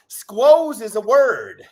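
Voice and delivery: squoze drawn out slow and loud; stress on squoze. Shown as a demonstration. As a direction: lean hard on the word squoze. Insisting on a Utah word.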